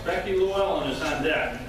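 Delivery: usual speaking voice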